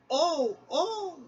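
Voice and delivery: high frightened shrieks; screaming. Acted. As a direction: shriek it out in alarm. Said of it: "screamed the freight cars".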